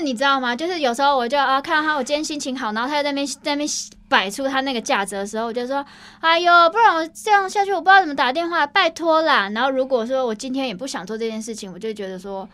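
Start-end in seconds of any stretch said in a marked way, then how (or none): 6.2-9.5 higher, louder sugary pleading; butter him up with a cute whine; playful coaxing plea. Retold or imitated. Coaxing the grumpy dispatcher on a good day, playful wheedling. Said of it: "微撒娇说".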